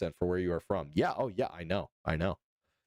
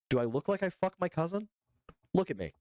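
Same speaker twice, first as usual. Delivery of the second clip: high-pitched, playfully affronted question; put-on outrage; mock indignation; 8 semitones higher than usual. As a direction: act offended at the guess, pitched up. Mock offence at chat's Alabama guess.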